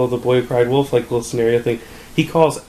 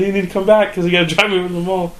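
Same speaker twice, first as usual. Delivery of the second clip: higher, put-out phone plea; retold exasperation, pitched up; annoyed; 7 semitones higher than usual. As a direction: act out being annoyed on the phone. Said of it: "Just, like, annoyed".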